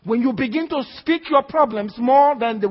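ordinary talk, nothing marked

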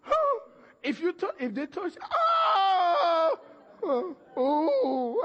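high, loud imitated moans at a touch; mock moaning. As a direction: overdo the sick man's pain. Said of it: "He goes".

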